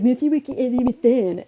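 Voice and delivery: lisped, exaggerated mock apology; mocking mimicry. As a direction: mimic the apology back with contempt. Mimics the clerk's apology back.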